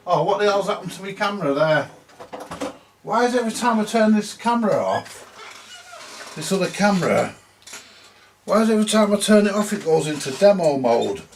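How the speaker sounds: venting real annoyance at a stubborn gadget; exasperated rant. Real, not acted. Repairer grumbling to viewers about his camera, exasperated. Camera keeps switching to demo mode.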